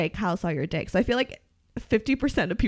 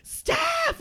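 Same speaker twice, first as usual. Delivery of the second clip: mock yell. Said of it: "like, screaming for me".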